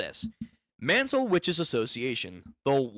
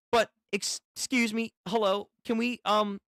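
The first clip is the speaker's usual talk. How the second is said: high, hesitant interruption; mildly flustered, higher-pitched stammer; 7 semitones higher than usual. Acted. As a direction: try politely to cut in, pitched up. Voicing flustered character trying to interrupt.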